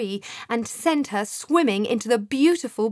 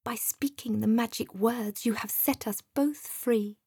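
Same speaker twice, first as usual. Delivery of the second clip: plain reading voice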